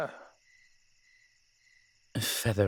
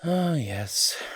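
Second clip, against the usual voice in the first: high and louder mock agreement; sarcastic yes; 8 semitones higher, 5 dB louder than usual. Demon mocking angels' closed-mindedness, sarcastic. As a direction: drip it with irony.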